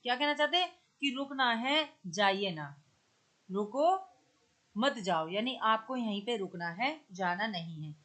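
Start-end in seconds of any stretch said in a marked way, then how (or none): 3.5-5.3 "रुको" rising, long pause, then lower "मत जाओ"; रुको के बाद विराम. Shown as a demonstration. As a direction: halt after "रुको", as at a comma, then "मत जाओ". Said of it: "कॉमा के आधार पे". ("रुको" के बाद लंबा विराम, फिर नीचे स्वर में "मत जाओ")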